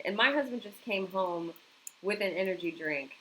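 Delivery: ordinary talk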